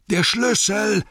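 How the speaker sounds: high, drawn-out urgent yell; Bilbo. A narrator's reading. Hobbit yelling for the key, high loud bellow. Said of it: "brüllte Bilbo".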